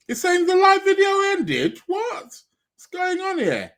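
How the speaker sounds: high, startled disbelief; suddenly alarmed the stream ended; shocked high cry of what. Real, not acted. Shocked live stream glitch.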